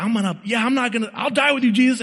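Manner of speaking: quick, high-pitched pledge from an impulsive disciple; bold Peter. Retold or imitated. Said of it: "And he's like".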